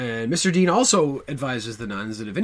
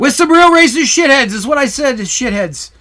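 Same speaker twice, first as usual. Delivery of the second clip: much higher, booming projection; exaggerated loud repeat; 12 semitones higher, 13 dB louder than usual. Shown as a demonstration. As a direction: belt it out so nobody can miss it. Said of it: "you need to talk like".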